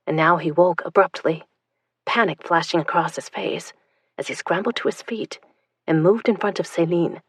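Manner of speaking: usual speaking voice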